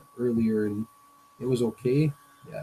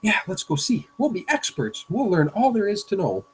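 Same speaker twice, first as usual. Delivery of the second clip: higher and louder, all keen to go learn; eager kid; 9 semitones higher, 5 dB louder than usual. Acted. Reading a cartoon to the class, the kids turn eager and excited. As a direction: pump it up like a kid with a new plan.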